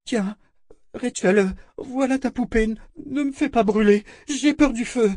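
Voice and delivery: high, panicky surrender; scared princess begging to be spared; frightened princess. Acted. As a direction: give in fearfully in a high girl's voice.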